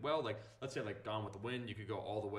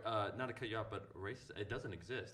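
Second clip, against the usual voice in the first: sarcastic; 3 semitones higher, 10 dB louder than usual. Pretend. Mock denial of racism.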